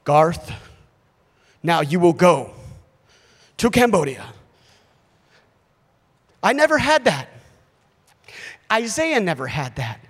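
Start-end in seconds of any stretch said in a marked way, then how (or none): 0.0-4.3 low, weighty voice of God giving orders; solemn divine command. Acted. Preacher mimics an expected call to missions, in mock-solemn command. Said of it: "we expect something like this".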